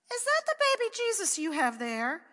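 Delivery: put-on high, loud child's question; a small boy amazed at his sister; little boy asking. A narrator's reading. Pat asks his sister.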